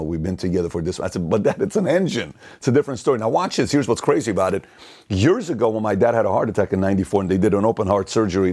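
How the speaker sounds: ordinary talk, nothing marked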